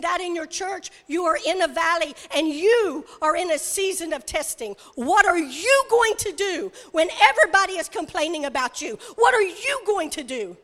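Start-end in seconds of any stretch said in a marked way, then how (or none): none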